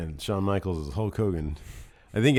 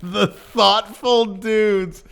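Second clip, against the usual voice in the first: mock announcer; 10 semitones higher, 5 dB louder, much slower than usual. Mocking the team name.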